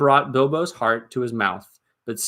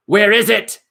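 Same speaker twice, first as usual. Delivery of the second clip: much higher and louder, an angry goblin cry; 10 semitones higher, 8 dB louder than usual. Acted. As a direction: bark the question across the cave. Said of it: "they cried".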